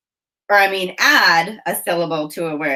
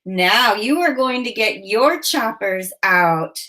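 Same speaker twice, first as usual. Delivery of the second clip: usual speaking voice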